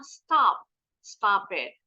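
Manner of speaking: "stop" with a wide open American vowel. Shown as a demonstration. Teacher contrasts the American "Stop it" for pupils. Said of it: "Tapi kalau orang Amerika".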